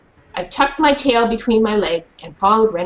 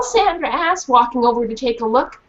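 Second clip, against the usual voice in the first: ordinary talk, nothing marked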